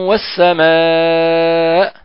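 والسماء drawn out very slowly with a nasal hum; recited with ghunna. Shown as a demonstration. Teacher recites the verse slowly for students. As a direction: chant والسماء long and nasal. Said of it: "إذن أعيد".

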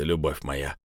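low, soft hissing; hissed. Acted. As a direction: hiss it through the door crack. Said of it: "прошипел Влад".

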